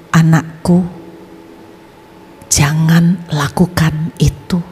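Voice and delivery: low, slow whisper of an unseen voice; mysterious voice. Retold or imitated. Unseen male voice whispers to the suicidal young man, low and slow. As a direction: whisper it like a voice from nowhere. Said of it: "Tiba-tiba muncul suara".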